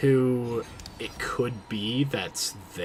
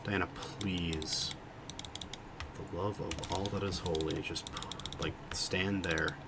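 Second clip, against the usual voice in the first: quiet pleading; 3 semitones lower, 10 dB quieter than usual. Real, not acted. Pleading with teammate in game.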